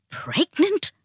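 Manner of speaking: high, incredulous burst; scandalized, pitch leaping up; outraged disbelief. A narrator's reading. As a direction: spit the word out as a shocked question.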